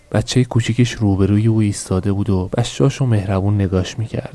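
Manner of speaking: plain reading voice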